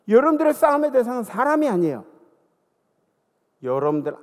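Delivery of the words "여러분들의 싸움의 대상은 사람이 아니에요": loud, raised preaching voice. Real, not acted. Pastor urging his congregation, preaching loud. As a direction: drive the point home.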